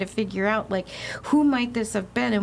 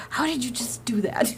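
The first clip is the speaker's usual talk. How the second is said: amazed whisper; 4 semitones higher than usual. Retold or imitated. Amazed guest quoting ghost trick.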